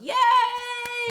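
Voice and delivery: high, loud happy yay; excited cheer. Real, not acted. Cheering a backed game.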